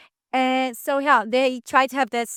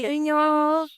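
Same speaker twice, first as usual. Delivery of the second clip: "Cariño" with a Spanish accent; 4 semitones higher than usual. Shown as a demonstration. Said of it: "You have the accent".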